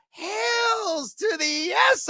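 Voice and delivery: high, drawn-out shout of 'yes'; gleeful booming hell yes; emphatic yes. Real, not acted. Emphatic yes to betting question.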